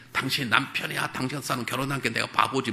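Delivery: a wife scolds her husband with contempt; nagging wife. Retold or imitated. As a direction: sneer at him like a fed-up wife. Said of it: "우리는 그래야 되는데".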